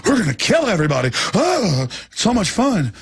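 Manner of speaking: high manic gloating; gleeful villain. Retold or imitated. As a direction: relish the killing like a maniac. Mocking gleeful elites.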